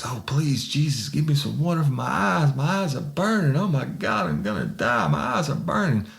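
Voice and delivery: high, wailing imitation of desperate pleas; mock begging. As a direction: mimic a man begging for relief from burning eyes. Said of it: "to the begging phase".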